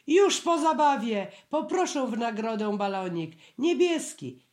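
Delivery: put-on high, loud child's shout; loud high cry of a little one wanting a balloon; shouting at full voice. A narrator's reading. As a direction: belt it out like a kid done with the doctor. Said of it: "krzyknęło na cały głos".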